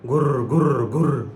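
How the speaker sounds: rolled whirring fan noise; trilled mechanical whirr; imitated fan whirr. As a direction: imitate a fan droning round.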